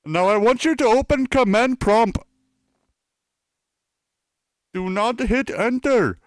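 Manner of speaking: high, loud put-on Indian scammer voice; Indian accent. Fake technician instructing a victim at the computer, high Indian accent. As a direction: order them about in a caricature Indian accent. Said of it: "I should stop my, uh, Indian accent".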